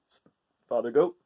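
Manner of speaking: ordinary delivery, nothing marked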